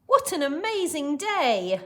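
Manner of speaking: high, loud, delighted exclamation; laughing delight. Acted. Missus Joshi delighting in her day, joyful. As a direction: exclaim it with joy. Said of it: "laughed Missus Joshi".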